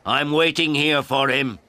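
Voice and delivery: put-on old man shouting through a closed door. A narrator's reading. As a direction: call out to her, loud and insistent. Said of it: "I shouted at her".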